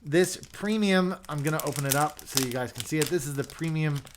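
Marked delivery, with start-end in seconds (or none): none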